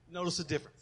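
normal talking voice

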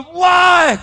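high, loud shouted why; retold shout. Biker shouting at the preacher, high and loud. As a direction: fling the single word out.

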